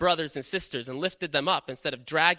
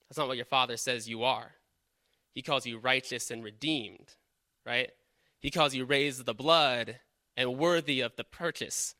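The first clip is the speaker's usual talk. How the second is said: ordinary talk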